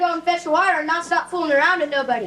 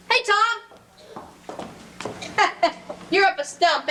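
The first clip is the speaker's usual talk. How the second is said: normal talking voice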